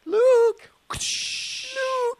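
very high falsetto call; mock film cry. Co-host playing a Star Wars scene, falsetto calling.

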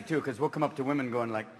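ordinary delivery

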